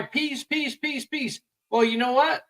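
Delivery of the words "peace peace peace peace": high, soft repeated plea; imitated chant of protesters, pitched up; mock cry for peace. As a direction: mimic their pleading in a high, light voice. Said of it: "everyone wants to cry".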